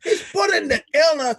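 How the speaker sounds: worked-up outrage; heated rant. Real, not acted. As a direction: shout down the unfairness, rushing. Heated rant about the Surgeon General.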